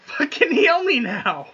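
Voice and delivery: high, drawn-out demand; angry demand. Real, not acted. Player run over demanding healing, angry demand. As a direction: insist on help, slow and high.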